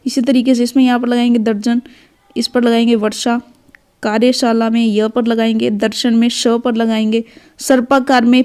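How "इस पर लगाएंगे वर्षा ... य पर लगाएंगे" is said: ordinary talk, nothing marked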